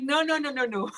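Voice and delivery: high, laughing no-no-no; laughing protest. Retold or imitated. Teacher protesting as student steals her pronunciation, high and laughing. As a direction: playfully stop the copying. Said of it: "I'm like".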